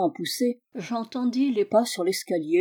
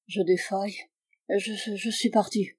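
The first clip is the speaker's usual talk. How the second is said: soft, halting sick man's voice; faint and failing; 5 dB quieter than usual. A narrator's reading. Sick Holmes tells visitor he is fainting, faint and failing. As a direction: stammer softly as if slipping away.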